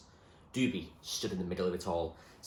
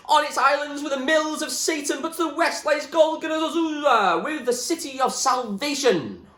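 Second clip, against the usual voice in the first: high, loud raving shout; wild yell; 11 dB louder than usual. Acted. William rants wildly at the top of the choir, shouting. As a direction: rant it like a madman. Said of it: "his mad shouting".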